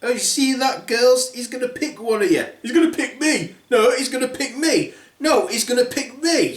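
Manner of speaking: high, bickering sister voices; squabbling stepsisters. Acted. Stepsisters squabbling over the prince in high voices. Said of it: "said the stepsisters".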